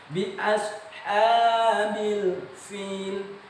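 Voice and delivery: long-held ashabil, FIL with f; high, slow chanted bi ashabil fil; correct recitation. Shown as a demonstration. Teacher repeating what must be understood, correct recitation. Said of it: "Itu yang harus dipahami".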